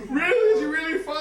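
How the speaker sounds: high, loud anxious squeal; frantic questioning. Retold or imitated. Wife questioning husband about baby, frantic. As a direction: squeal it back in mock desperate hope. Wife's frantic echo of reassurance.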